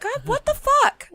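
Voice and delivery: very high, slow disbelieving cry; incredulous outburst. Retold or imitated. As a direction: shriek in disbelief. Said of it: "I was like".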